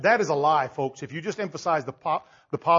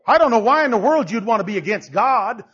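ordinary delivery

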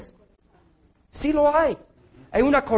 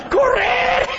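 mock shout; 12 semitones higher than usual. Preacher joking he'd run from the match, high comic yell. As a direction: shout it like a kid bolting.

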